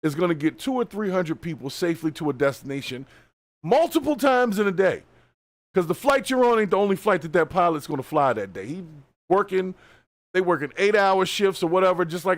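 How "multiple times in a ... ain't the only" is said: raised, high, emphatic; emphatic shout. Real, not acted. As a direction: hammer the point home loudly. Emphatic rant about pilots' workload.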